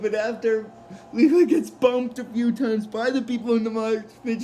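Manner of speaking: normal talking voice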